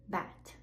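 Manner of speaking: bat ending in a crisp airy T; unvoiced final T. Shown as a demonstration. Teacher contrasting bat with Bad. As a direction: clip the T in bat with no voice. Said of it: "then the unvoiced version".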